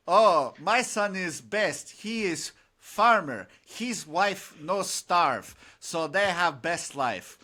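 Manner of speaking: put-on slow, lightly Russian-accented farmer; a hint of a Russian peasant telling a joke. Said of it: "you want to hear a Russian joke".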